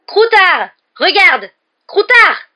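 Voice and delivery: high, very loud yells; frantic, enraged shouting; mad with rage. A narrator's reading. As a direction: shout each word in fury. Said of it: "fou de rage".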